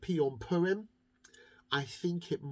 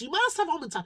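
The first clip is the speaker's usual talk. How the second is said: higher insistent plea on must; 11 semitones higher, 4 dB louder, faster than usual. Acted. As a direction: push hard on must, higher and louder. Insisting to baker.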